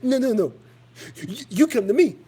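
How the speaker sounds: mock him squeakily backing out; imitating the caller. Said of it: "he's like".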